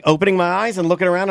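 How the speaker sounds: ordinary talk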